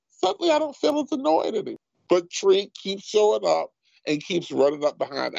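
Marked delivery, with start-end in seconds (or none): 0.1-1.8 high, fast, breezy voice; mock-cheerful relief; mock Atticus. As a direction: rattle it off high and airy like a relieved snob.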